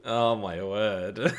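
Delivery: slow words stretched through real laughter; laughing. Real, not acted. Streamer laughs at Everton's Angry Birds sponsor, slow laughing words. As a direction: crack up while saying it.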